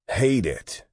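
sudden high, loud frustrated outburst; near yell. A narrator's reading. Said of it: "God all but yelled".

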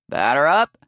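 loud shout with a rising end; ringing ballfield call; umpire's call. A narrator's reading. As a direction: holler for the next batter. Umpire calls next batter.

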